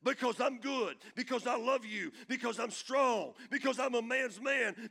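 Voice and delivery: loud, high boastful vow; proud boast. Acted. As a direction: brag about your own strength. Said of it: "And Peter said".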